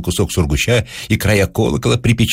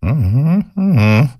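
higher, wordless mumbled hum; thoughtful mumbled uh-huh; muttering grunt; 6 semitones higher, slower than usual. A narrator's reading. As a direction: grumble it to yourself, lips closed. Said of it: "пробурчал он".

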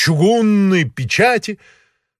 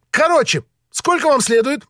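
high, quick, impatient official; haughty high-pitched impatience; peacock voice; 11 semitones higher than usual. A narrator's reading. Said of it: "сказал он павлиньим голосом".

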